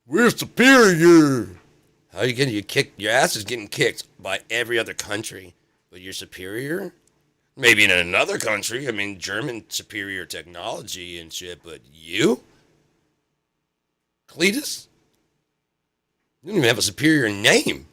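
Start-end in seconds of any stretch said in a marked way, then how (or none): 0.1-1.5 slow, high mocking drawl. Mocking redneck boast. 14.3-14.9 mock high call. Mocking a hillbilly name.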